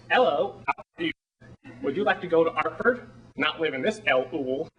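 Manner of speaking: attempted Cockney, dropping H's; Cockney accent. Shown as a demonstration. Actor practising Eliza's Cockney at home for an audition, Cockney accent. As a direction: have a go at a Cockney greeting. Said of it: "the whole Cockney thing of".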